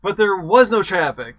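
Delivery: high and loud cop retort; a loud, high deadpan cop; cop's comeback. Acted. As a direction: fire it back loud, a cop who isn't buying it. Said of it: "To which the officer responds".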